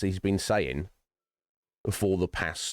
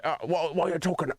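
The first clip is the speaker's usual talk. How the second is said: mocking baffled skeptic; 8 semitones higher, faster than usual. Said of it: "They're like".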